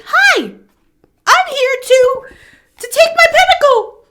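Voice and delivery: high, squeaky, slow little creature asking politely; a small eager creature squeaking up at a huge dragon; tiny seahorse creature. Pretend. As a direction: pipe up sweetly like a tiny hopeful sea creature. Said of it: "And it's like".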